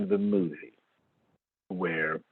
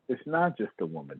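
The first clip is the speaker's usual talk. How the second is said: usual speaking voice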